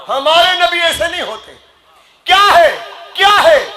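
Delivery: high, insistent sermon cry; impassioned preacher's challenge; indignant preaching. Real, not acted. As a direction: fire the questions out at high pitch. Preacher rebuking, punching repeated questions.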